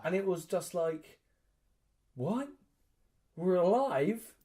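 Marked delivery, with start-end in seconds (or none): 2.1-4.3 high, questioning surprise; retold disbelief. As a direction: act out stunned disbelief at surviving. Retelling stunned relief after battle.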